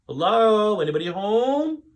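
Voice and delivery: high, loud calling voice. Acted. Papa arriving through the back door, calling out. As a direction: call through the house. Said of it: "called Papa".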